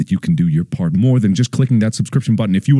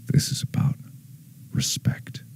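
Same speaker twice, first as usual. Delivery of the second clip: dramatic whisper, much softer; 9 semitones lower, 12 dB quieter than usual. Acted. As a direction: lean in and breathe it out. Dramatic hush on key word.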